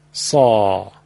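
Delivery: deep emphatic سا from the throat; heavy throaty saa. Shown as a demonstration. Teacher demonstrating emphatic Arabic ص to listeners. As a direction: voice سا with full throat weight.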